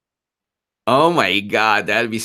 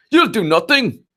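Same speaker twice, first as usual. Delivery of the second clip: high Irish shout of the line; doing a mock Conor McGregor taunt, pitched up; Conor McGregor impression; 11 semitones higher, 4 dB louder than usual. Viewer's request, a Conor McGregor Irish taunt. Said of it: "doing an impersonation of ... Conor McGregor".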